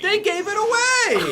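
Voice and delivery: shocked disbelief; surprised. Real, not acted. Co-reader shocked the warning spoils the plot.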